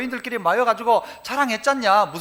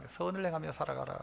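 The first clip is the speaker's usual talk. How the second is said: quiet repeat; 7 semitones lower, 16 dB quieter than usual. Shown as a demonstration.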